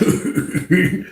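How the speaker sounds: put-on soft, breathy chuckle from an old man; old man's laugh. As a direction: laugh at them from the side. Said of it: "started laughing like".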